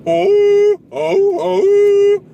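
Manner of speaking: loud, high, sustained hooting horn; imitated horn blast. Norse traveler blowing his horn in a skit, imitated horn blast. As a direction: hoot like someone blowing a big horn. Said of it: "had a horn, and he would just go like".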